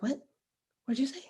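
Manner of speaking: softly acted puzzled question; hushed mock confusion. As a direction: ask softly, as if you couldn't hear. Yeller straining to hear quiet talker.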